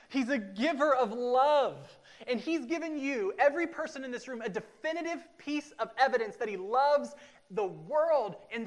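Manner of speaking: swelling pulpit preaching. Acted. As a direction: preach it with growing force. Preaching at full force.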